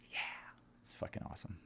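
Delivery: unvoiced whispered aside; sarcastic whispered aside. Real, not acted. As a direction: breathe it as a secret.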